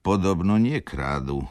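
ordinary delivery

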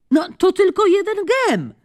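calling out. Acted. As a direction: coax her from across the court. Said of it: "zawołała Charmaine".

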